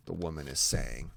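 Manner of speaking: plain reading voice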